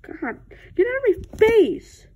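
high, loud and irritated; angry snap. Acted. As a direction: tell them off, fed up. Acted quarrel, pushing away.

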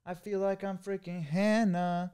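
slow, high, softened pop-star impression. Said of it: "What would [name] say".

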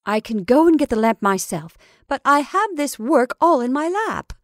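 loud, put-out protest; crying out. A narrator's reading. Mrs Brigham with work in her lap, crying out. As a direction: protest loudly, pitch climbing toward the end. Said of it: "cried Missus Brigham".

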